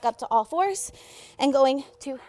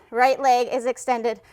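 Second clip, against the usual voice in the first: ordinary delivery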